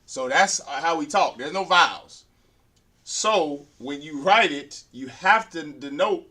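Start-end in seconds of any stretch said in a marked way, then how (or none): none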